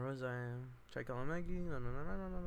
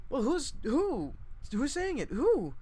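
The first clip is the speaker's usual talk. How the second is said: much higher and louder, slow; shocked demand; 13 semitones higher, 6 dB louder, slower than usual. Acted. Meg demanding who accuses Babe, loud and high. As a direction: insist on an answer.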